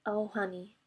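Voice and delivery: soft, subtle "Oh, honey" with the voice breaking a little; a quiet, sad "Oh, honey" full of bad news; the right way, a gentle breaking "Oh, honey". Shown as a demonstration. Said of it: "very subtle".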